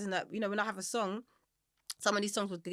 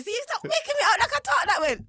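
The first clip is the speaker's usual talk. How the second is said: high, loud, laughing Jamaican mum voice; mum's Jamaican accent; 12 semitones higher, 9 dB louder than usual. Retold or imitated. Mum protesting the impression, laughing Jamaican accent. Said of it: "She's like".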